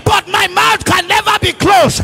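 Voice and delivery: forceful, full-voiced sermon; full-force preaching. Real, not acted. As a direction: press the point on the congregation.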